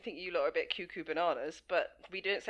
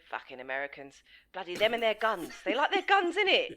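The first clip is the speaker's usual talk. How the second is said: higher-pitched mocking Brit, laughing through it; mock British grumbler; 4 semitones higher, 6 dB louder than usual. Mocking how British people moan about Americans, higher, laughing. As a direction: parody a grumbling average Brit. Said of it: "They always go".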